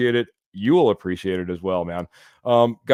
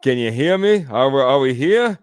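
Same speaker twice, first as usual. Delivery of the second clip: impersonating Joey Clams; 2 semitones higher, 4 dB louder than usual. Retold or imitated. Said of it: "That's my best impersonation".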